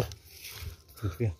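whisper. Real, not acted. Scared whisper during ghost hunt.

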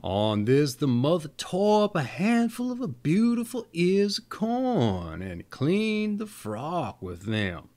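plain reading voice

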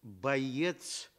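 ordinary delivery

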